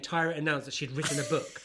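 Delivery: ordinary talk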